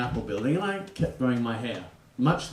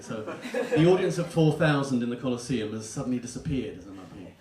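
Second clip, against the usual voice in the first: ordinary delivery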